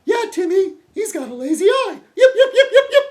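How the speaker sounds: very high, loud squeaky yapping; yappy lap dog. Acted. Mocking Pops as the lap dog egging Timmy on, high yapping voice. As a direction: yelp along like an eager little sidekick dog. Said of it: "going along like, like his little, uh, lap dog".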